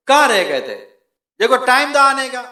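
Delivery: loud, high-pitched telling-off; father's scolding. Acted. Father scolding his son for coming late, angry telling-off. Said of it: "कभी बाप डांट भी देता है".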